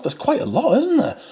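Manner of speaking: ordinary talk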